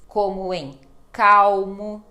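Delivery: calmo said slow as kaw-mo, L like U; teacher drawing out calmo with an U sound for the L. Shown as a demonstration. Ensinando os alunos: calmo devagar, L com som de U. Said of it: "quando ela está no meio de palavra".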